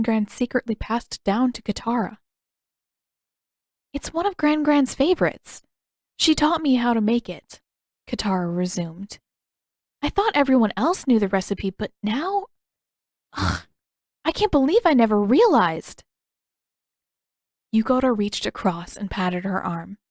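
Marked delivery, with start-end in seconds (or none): 3.9-7.6 lightly voiced girl, higher and casual; a girl chatting, then catching herself; Katara, easy then unsure; young Katara. Katara explains the recipe. 10.0-16.0 lightly voiced girl, rising dismay with a groan; a girl annoyed at herself; Katara, disbelieving and exasperated; frustrated Katara. Katara upset by realizing.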